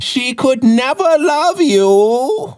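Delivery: high, drawn-out wizard pronouncement; crooked wizard voice. Retold or imitated. Homeless wizard pointing at his crush, high slow wail. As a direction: proclaim it slowly like a high-pitched wizard. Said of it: "pointed his crooked wizard finger at my crush".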